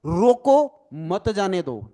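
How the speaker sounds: रोको loud and drawn out, pause, then मत जाने दो; pause after रोको. Shown as a demonstration. Teacher showing the meaning change, pause placed straight after रोको. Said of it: "meaning ही अलग हो जाएगा".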